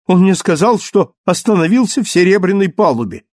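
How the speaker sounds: high, loud outraged rant; furious. Acted. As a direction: spit out her indignation. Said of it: "разъярённая". (в ярости)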